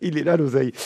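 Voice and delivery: high-pitched, amused discovery; gleeful mock realisation. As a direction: play the penny dropping, pitched right up. Mock sudden realisation about money.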